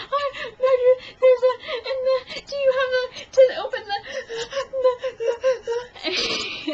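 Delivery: very high mock wailing, breaking up into gasps; hysterical sobbing, imitated. Woman explaining to fence owner, hammed-up hysterical crying. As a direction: choke out fragments through exaggerated sobs. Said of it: "I'm hysterical still".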